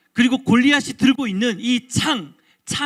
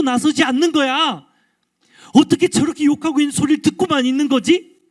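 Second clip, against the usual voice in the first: high, heated outrage; acted burning anger, pitched up; indignant David; 6 semitones higher, 3 dB louder than usual. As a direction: demand to know why nobody steps up. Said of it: "뜨거운 분노가 일어나기 시작했어요".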